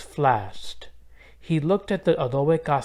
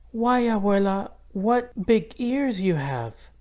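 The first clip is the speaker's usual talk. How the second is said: put-on wondering little girl; 7 semitones higher than usual. A narrator's reading. Isabel questioning the disguised wolf.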